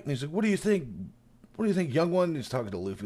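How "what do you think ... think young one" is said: high-pitched comic challenge; mock old mayor. Mayor turning to Luffy for support, mock rousing. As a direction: put it to the youngster, playfully acted.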